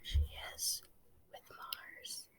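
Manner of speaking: hushed whisper to the class. Real, not acted. Teacher whispering the story's ending to the class. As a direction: breathe the words, no voice. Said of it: "teacher whispers story ending".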